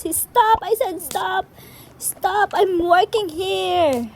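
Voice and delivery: fed up with griefers, mock angry shouting; mock angry yell. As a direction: yell at them to stop, slowly at the end. Yelling at trolling players.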